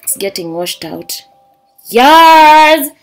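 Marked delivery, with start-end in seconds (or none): 1.9-2.9 loud, high delighted yes; excited yes. Real, not acted. Unboxing for viewers, a loud thrilled yes.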